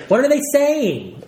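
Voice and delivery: high, drawn-out mock pleading; Simon. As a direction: act out the left-out kid begging to know. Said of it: "Simon's just like".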